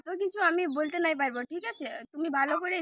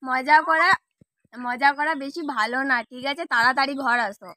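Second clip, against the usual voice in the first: loud exasperated telling-off; annoyed scolding; 7 dB louder, slower than usual. Real, not acted. Wife on the phone scolding her husband for joking, loud and exasperated. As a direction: scold him loudly for joking around.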